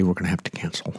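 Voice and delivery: breathy joking whisper; mock whisper. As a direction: drop to a conspiratorial whisper for the punchline. Mock conference cancellation joke.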